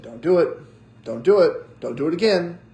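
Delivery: loud, firm scolding, rising in force; stern parental warning. Retold or imitated. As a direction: warn a toddler firmly and loudly. Parent warning son throwing food.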